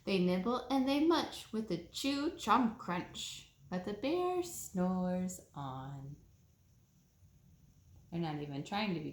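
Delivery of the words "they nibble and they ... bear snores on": slow, sing-song read-aloud voice; storybook reading. A narrator's reading. Reading a bedtime story to children, slow and sing-song. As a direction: read it slowly like a bedtime picture book.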